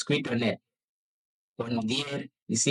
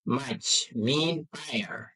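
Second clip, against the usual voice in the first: slow teaching; 3 semitones lower, much slower than usual. Shown as a demonstration. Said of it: "teaching slowly".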